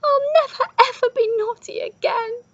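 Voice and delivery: high, loud wail; crying out. A narrator's reading. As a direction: wail it as a frightened runaway's promise.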